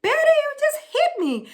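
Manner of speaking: high-pitched, quick mock protest; imitating shocked woman. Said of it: "And she was like".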